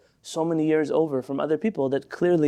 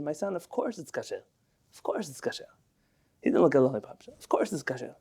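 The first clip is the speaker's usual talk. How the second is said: much softer, low and kindly; gentle reassurance; 3 semitones lower, 5 dB quieter than usual. Retold or imitated. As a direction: soothe the little boy, softly. Rabbi smiles, reassures small child.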